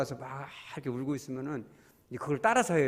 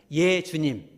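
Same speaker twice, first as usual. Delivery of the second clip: louder prayer; 6 dB louder, much slower than usual. Shown as a demonstration. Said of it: "다시".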